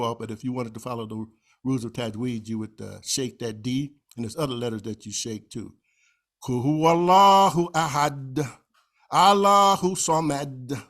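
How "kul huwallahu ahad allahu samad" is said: slow recitation, the d of ahad and samad bounced; shaken final D. Shown as a demonstration. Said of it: "Shake the D".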